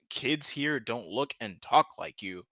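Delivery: usual speaking voice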